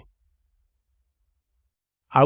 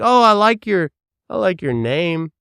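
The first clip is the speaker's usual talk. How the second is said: much higher, louder, fake-bubbly gush; mock gushing compliment; 9 semitones higher, 9 dB louder than usual. Mocking his own small talk, hammed-up gushing. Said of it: "that's how I talk".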